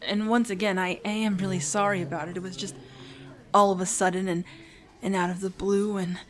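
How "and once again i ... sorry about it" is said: ordinary talk, nothing marked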